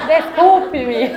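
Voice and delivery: helpless laughing apology, drawn out; apologizing through laughter. Real, not acted. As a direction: say sorry while cracking up, high and loud. Laughing after own slip. (pedindo desculpa às gargalhadas)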